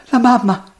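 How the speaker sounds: put-on high, plaintive girl; tearful. A narrator's reading. Crying girl answering the prince, tearfully. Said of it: "perché piangeva tanto".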